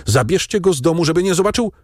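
high, hurried, anxious command; urgent order. A narrator's reading. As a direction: rush the order out in alarm. Frantic father orders servants.

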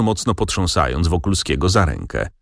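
plain reading voice

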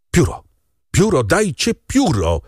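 high-pitched demand, last words stretched; urgent call. A narrator's reading. Doctor demanding a quill for the dying man, calling urgently. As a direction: call out urgently for a needed tool. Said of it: "zawołał lekarz".

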